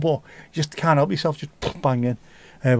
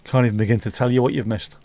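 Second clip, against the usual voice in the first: ordinary delivery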